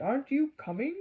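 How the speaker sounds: a put-on conductor voice; conductor's voice. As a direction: invite the child aboard like a jolly conductor. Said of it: "he said".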